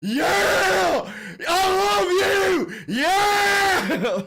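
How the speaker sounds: high, loud crazed fan shriek; screaming fans. Retold or imitated. Streamer mocks Trump fans cheering in a screaming shriek. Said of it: "his fucking fans are like".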